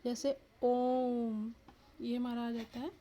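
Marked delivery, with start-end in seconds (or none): 0.6-1.5 ओम with a very long, sustained ओ; prolated vowel. Shown as a demonstration. Teacher demonstrating the triple-length vowel, greatly prolonged. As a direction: draw ओम out to triple length, humming into the म. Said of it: "तीन गुना समय लगे".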